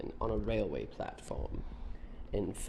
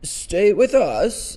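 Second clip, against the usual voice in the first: loud, high, slow startled echo; nervous; 11 semitones higher, 14 dB louder, much slower than usual. A narrator's reading. Mister Brown echoes his wife's idea, nervously. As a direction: repeat it in mock alarm. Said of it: "repeated Mister Brown nervously".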